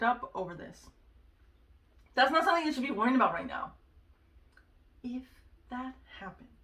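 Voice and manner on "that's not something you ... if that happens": louder, fast no-nonsense telling-off; firm self-scolding. Retold or imitated. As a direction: snap yourself out of it. Scolding herself over her worrying.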